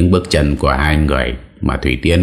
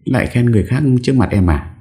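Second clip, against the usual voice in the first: higher, fast jealous pout; pouting wife; 8 semitones higher than usual. Acted. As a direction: sulk at him for praising another woman. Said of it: "Thủy Tiên phụng phịu". (giọng vợ phụng phịu ghen, cao và nhanh)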